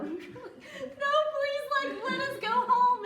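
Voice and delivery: high, laughing plea to go home; pleading whine. Retold or imitated. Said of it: "they were like".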